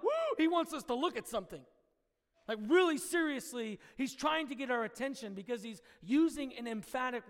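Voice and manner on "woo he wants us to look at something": high, quick startled whoop; imitated excited reaction. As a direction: react as if jolted to attention. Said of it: "if they were reading that in the Philadelphians".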